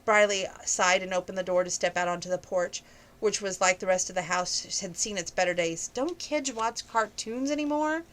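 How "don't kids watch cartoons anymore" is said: slow, higher mock-despairing question; pretend despair. A narrator's reading. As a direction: ham up the despair, pleading slowly. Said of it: "she countered in the pretend despair".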